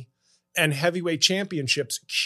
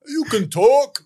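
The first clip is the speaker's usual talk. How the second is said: Rocky; 8 semitones higher, 3 dB louder than usual. Acted. Plays Rocky answering Adrian.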